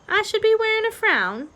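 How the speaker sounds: high, questioning misheard echo; puzzled and high. A narrator's reading. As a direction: ask it high-pitched, baffled by what was heard. Meg mishears the rat, puzzled.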